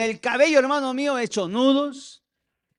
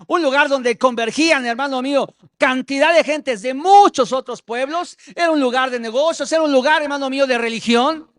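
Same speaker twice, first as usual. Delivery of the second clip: más fuerte, predicando con fervor; predicación ardiente; 5 semitones higher, 8 dB louder than usual. Acted. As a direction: clama a la congregación con fuerza. Predica a toda fuerza. (pastor predicando a gritos a su iglesia)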